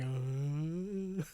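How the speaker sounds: lightly voiced dazed groan; dazed guards. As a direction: trail out a mindless uh. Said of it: "basically all going".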